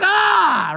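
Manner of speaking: loud high mock yell; hammed-up despairing "ah"; exasperated cry. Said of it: "I'm like".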